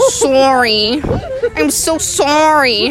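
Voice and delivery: mock Canadian, "sorry" stretched as "SORE-ee"; slow, exaggerated Canadian apology; imitated Canadian accent. Host imitating Canadians for Australian listeners, exaggerated Canadian accent. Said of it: "like a mix between sauce and story".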